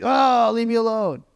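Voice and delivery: high, exasperated mother; annoyed mom. Retold or imitated. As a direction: brush the kid off, fed up. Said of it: "Mom's voice is like".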